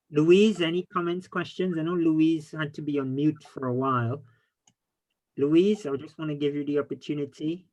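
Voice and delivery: ordinary talk, nothing marked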